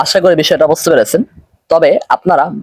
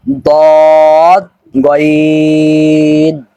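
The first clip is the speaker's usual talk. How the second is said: very slow, each letter name stretched; drawn-out letters; 3 dB louder, much slower than usual. Shown as a demonstration. Teacher stretching letter names for students. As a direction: hold every vowel long and even. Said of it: "টেনে টেনে".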